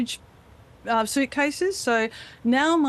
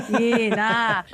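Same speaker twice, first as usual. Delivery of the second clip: hammed-up ocker Australian accent; exaggerated broad Australian; broad Aussie; 3 dB louder than usual. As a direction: put on a broad Aussie twang. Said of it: "talking like this".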